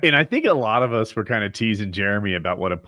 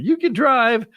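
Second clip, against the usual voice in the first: mimicking wife; 4 dB louder, slower than usual. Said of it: "And she's like".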